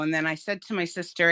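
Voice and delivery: usual speaking voice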